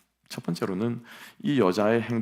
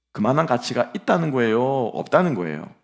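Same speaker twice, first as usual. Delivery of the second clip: usual speaking voice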